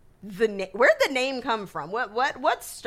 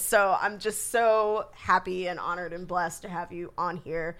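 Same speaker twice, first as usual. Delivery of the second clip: usual speaking voice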